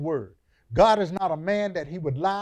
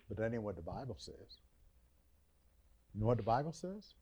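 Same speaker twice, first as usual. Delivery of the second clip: low, soft preacher's storytelling; quiet sermon teaching; 7 semitones lower, 8 dB quieter than usual. Acted. Preacher softly setting up the Passover story. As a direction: draw them in quietly before the point.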